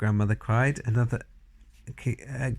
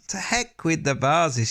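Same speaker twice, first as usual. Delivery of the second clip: reckless shout; 6 semitones higher, slower than usual. A narrator's reading. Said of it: "she shouted".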